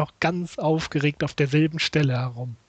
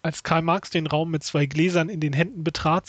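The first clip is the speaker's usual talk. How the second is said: ordinary talk, nothing marked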